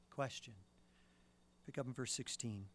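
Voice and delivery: ordinary talk, nothing marked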